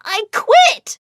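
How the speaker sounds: high, loud, drawn-out outburst; yelling. A narrator's reading. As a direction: yell it at the end of your rope. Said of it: "I began to yell".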